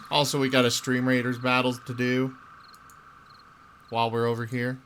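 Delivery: normal talking voice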